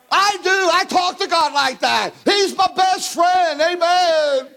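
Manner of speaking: high, ringing revival preaching; preaching at full force. Acted.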